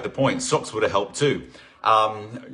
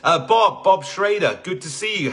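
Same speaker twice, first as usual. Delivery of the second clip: ordinary talk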